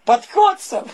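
talking through laughter; jolly, laughing as he proposes the toast. Acted. (весело, сквозь смех)

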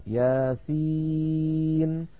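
ياسين with the ي drawn out slowly; recitation. Shown as a demonstration. Teacher reads the next example aloud. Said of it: "المثال الذي بعده".

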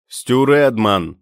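loud, slow call into the dark; shouting. A narrator's reading. Stu shouts his name to the hidden sentry, loud and slow. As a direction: call your name out across the snow. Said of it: "крикнув він у темряву".

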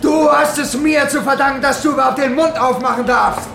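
high, loud, furious yelling, the words slowing near the end; furious shout. Acted. Gang boss screaming at the miner he exploits, furious. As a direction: roar him down and put him in his place.

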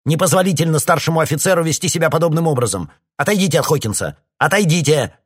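officer yelling at the colonel to back off; angry shouting. A narrator's reading. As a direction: shout him down with authority. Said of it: "закричал Грей".

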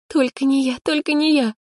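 high, anxious plea under a spell; whispered incantation. A narrator's reading. Girl praying not to draw the lot, anxious incantation. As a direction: chant it high like a desperate charm. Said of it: "шептала она заклинание".

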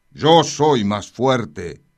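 loud, slow defiant boast; boastful sword. Acted. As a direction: drag it out proudly. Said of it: "volvió a decir la espada".

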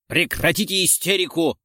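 high-pitched angry rebuke. A narrator's reading. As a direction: cut off the panic angrily. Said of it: "разозлился Террачини". (сердитый окрик)

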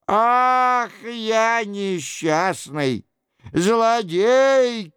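high, drawn-out cry of self-reproach; wailing cry. A narrator's reading. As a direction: wail like a wretched penitent. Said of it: "кричал Горанфло". (горестный высокий вопль)

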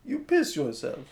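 put-on thick, hammy Russian accent. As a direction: say it like a bad Russian movie villain. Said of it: "That was my Russian accent".

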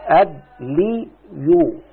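أدلي يو stretched very slowly, each sound held; drawn-out demo form. Shown as a demonstration. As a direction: draw out أدلي يو for the student. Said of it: "نفس القصة هي أدلي وو". (نطق أدلي يو ببطء شديد)

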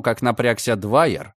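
usual speaking voice

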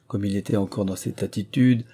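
plain reading voice

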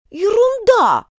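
high, bold retort; осмелев. A narrator's reading. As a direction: snap back with new courage. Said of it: "отвечала, осмелев Алиса". (смело, с вызовом)

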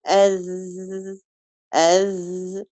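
أز said slowly and softly, ذال held; held dhal. Shown as a demonstration. Teacher showing a steady, held ذال. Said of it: "لكن افرض".

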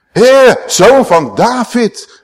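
a high, loud, slow call; crying out. Retold or imitated. The humble call out to Jesus for mercy, high and slow. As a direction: call out to him, stretching each word.

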